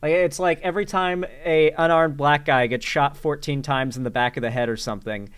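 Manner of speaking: normal talking voice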